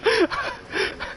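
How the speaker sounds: high wheezing silent laugh; helpless wheezy laughter; breathless laugh. Real, not acted. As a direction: let it out as an airy, high-pitched cackle. Cracking up at his own idea.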